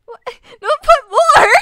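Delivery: real high-pitched, very loud yelp of protest; startled shout. Real, not acted. Streamer protesting to chat about the image, startled shout.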